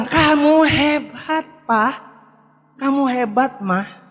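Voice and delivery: slow, lingering praise with rising ends; drawn-out praise. Retold or imitated. Family members praising each other, slow and admiring. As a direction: savour the compliment.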